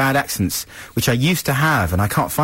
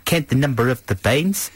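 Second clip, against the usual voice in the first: hammed-up South African-style accent, pitched up a bit; mock South African accent; 4 semitones higher than usual. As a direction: parody a mangled accent that lands in South Africa. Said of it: "It is sort of South African".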